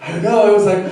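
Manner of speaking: ordinary talk, nothing marked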